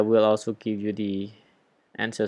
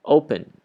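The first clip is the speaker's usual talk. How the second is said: "Open" with a louder first O, O-pen; first-syllable stress; 5 semitones higher, 7 dB louder than usual. Shown as a demonstration. Teacher shows why we don't double N, first-syllable stress. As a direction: punch the O at the start of "Open". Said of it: "The stress is on the first O".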